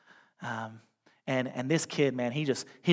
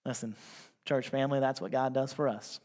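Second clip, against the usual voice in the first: ordinary talk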